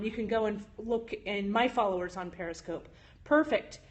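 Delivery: ordinary delivery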